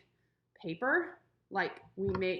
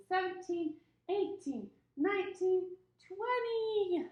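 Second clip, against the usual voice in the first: sing-song counting; 6 semitones higher, much slower than usual. Shown as a demonstration. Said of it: "let's count to twenty".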